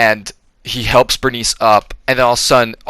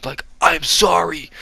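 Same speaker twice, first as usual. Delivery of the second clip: higher, softer put-on apology; Cage impression; 5 semitones higher, 5 dB quieter than usual. Cage apologizing to Bernice, higher, softer hammy impression. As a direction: confess it in a hammed-up soft Cage voice. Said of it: "he told her, he's like".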